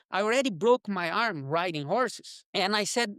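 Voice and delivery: ordinary delivery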